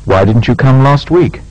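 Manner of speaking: "Why didn't you come last week?" rising steadily, short fall on "week"; a frank, polite question that climbs and dips at the end; "Why didn't you come last week?" with a continuous rise and a final fall; rising tune, short final fall. Shown as a demonstration. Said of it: "Make similar questions using why or how".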